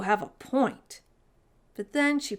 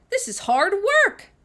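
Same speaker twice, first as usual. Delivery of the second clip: put-on high, loud girl's whine; Sophie complaining; 12 semitones higher, 9 dB louder than usual. A narrator's reading. Fed-up girl griping to Mister Pudding, loud and high.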